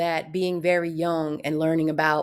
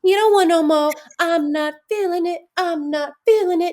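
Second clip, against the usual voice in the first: higher, louder diva attitude; 9 semitones higher, 5 dB louder than usual. Retold or imitated. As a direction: toss it off like a pop diva.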